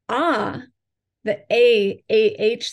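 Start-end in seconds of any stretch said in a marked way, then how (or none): 0.1-0.6 "Ah" sounded alone and held open; open vowel demo. Shown as a demonstration. Introducing the heart chakra sound, an open, held "Ah". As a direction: draw out an open "Ah". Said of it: "that ah sound is very opening".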